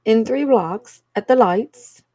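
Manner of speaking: lightly voiced navigation assistant; lightly imitated UK Siri. Streamer mimicking her UK Siri giving directions, lightly imitated. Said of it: "how my U K Siri would give me directions".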